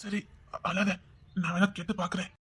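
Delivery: hushed, low reassurance; quiet consoling. Acted. Man telling crying girl he will ask, hushed. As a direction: soothe very softly.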